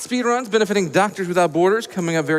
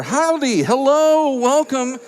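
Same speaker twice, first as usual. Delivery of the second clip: ordinary talk, nothing marked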